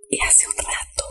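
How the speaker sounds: hushed, very soft confiding; voiceless, secretive telling; whispering. Real, not acted. Confiding what happened, whispered.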